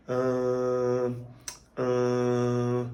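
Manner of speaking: steady hum, mouth kept open; nasal humming. Shown as a demonstration. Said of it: "say the humming".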